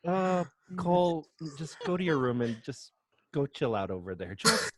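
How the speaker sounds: mock weary scolding; exasperated parent. Parent exasperated at the toddler's insult, weary. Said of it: "And I'm like".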